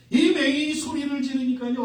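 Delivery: normal talking voice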